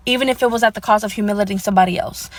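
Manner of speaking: usual speaking voice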